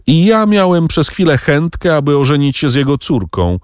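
high-pitched, understated shudder. A narrator's reading. Debray recalls nearly marrying the prosecutor's daughter, shuddering slightly. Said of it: "wzdrygnął się Debray".

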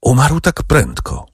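a touch of shocked compassion; sincere compassion. A narrator's reading. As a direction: say it with pained, sincere sympathy. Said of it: "rzekł z niekłamanym współczuciem".

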